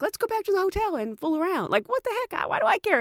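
mock his offer scornfully; sarcastic high mockery.

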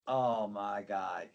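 slow, low, hushed oh my God; dread. Retold or imitated. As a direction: let it sink in that you're caught. Seeing the boss's shoes.

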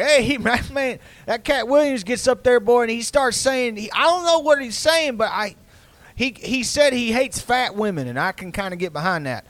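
put-on high, drawling good ol' boy; a hint of a Southern uncle rambling. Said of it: "he's like".

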